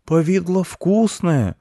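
put-on high, slow, loud child's protest. A narrator's reading. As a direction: wail it like an offended boy. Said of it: "запротестовал мальчик". (протестующий детский крик)

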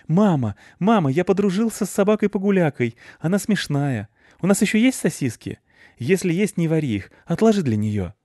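put-on high, eager little boy calling mama; a breathless boy bursting with news; excited child. Boy excitedly tells mom.

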